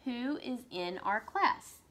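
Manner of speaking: Who is in our class? slow with who rising; slow question demo. Shown as a demonstration. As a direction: read as a question. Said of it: "That's a question".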